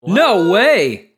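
loud, high "no way"; shocked disbelief, blurt it out; surprised. Real, not acted. Amazed someone prefers these crackers.

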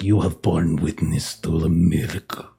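low, solemn voice of a devout priest; praising zealot. Acted. A zealot tells Sister Nura of the miracle, low solemn praise. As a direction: proclaim it low and reverently. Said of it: "he will literally call in praise".

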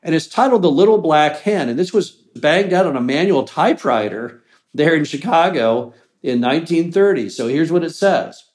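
ordinary talk, nothing marked

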